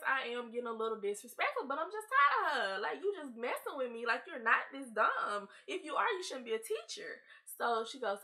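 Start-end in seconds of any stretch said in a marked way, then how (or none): none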